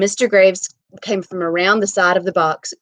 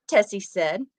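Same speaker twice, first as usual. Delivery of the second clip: usual speaking voice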